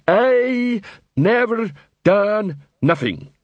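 very slow, high-pitched troll wail; insistent troll protest. A narrator's reading. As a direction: stretch every word out in dogged denial. Troll insists again, drawn out.

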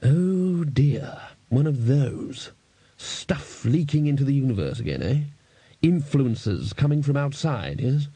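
slow, knowing dismay; drawn-out, concerned deduction; musing senior wizard. A narrator's reading. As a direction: think it through aloud, piece by piece. Ridcully muses aloud.

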